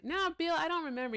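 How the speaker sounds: high sudden no; correcting a chat viewer, high and startled; surprised protest. Real, not acted. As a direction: yelp a quick denial at Bill.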